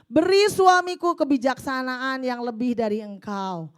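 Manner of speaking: loud, impassioned prayer voice read to the congregation; fervent prayer reading. Acted. Preacher reading a wife's prayer aloud, fervent and loud. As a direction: cry out the prayer like a battle cry.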